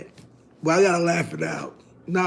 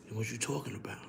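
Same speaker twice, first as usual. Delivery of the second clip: whispered, much softer mob meeting talk; mock mafia whisper; 6 semitones lower, 16 dB quieter, much faster than usual. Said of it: "they talk low".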